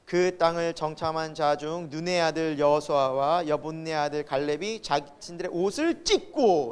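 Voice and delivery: slow, low, even Bible reading; measured read-aloud of scripture. Acted. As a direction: recite the verse steadily and unhurriedly. Reads verse six aloud.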